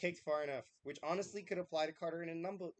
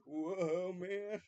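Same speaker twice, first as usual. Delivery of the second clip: surfer dude; 3 dB louder than usual. Retold or imitated. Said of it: "You sound like a shitty surfer".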